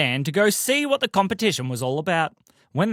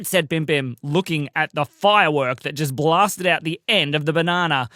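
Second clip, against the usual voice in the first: ordinary delivery, nothing marked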